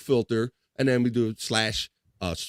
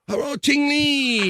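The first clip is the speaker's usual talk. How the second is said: very high, slow call; hyped-up hello; 4 dB louder, slower than usual. Real, not acted. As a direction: stretch the greeting out way up high. Host hails arriving viewer.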